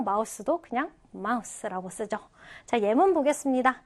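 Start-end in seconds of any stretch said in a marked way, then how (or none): none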